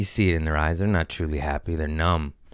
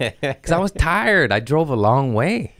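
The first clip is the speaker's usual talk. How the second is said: whiny excuse; 7 semitones higher, slower than usual. Retold or imitated. Comic excuse.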